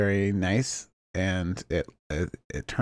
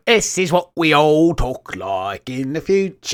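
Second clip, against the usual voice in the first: higher, louder, slow mock Cockney; Cockney future accent; 7 semitones higher, 6 dB louder than usual. Time traveller proclaiming in hammed Cockney. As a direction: ham up a Cockney voice.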